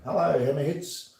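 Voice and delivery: put-on Australian greeting, lifting at the end; playful slip into Australian; Australian accent. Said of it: "went all Australian".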